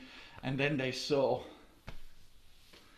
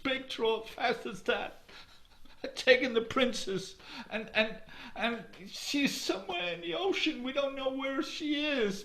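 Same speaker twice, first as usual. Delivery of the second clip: high, wavering voice of grieving folk with a laugh; lamenting mourners; 11 semitones higher than usual. Acted. Grieving people telling the hero the troll took their princess, lamenting. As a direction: pour out the sad news of the princess.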